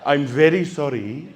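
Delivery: lightly voiced polite officer, a touch of laughing; prim, apologetic officer voice, mildly put on; a hint of a fussy rent-a-cop; parking officer.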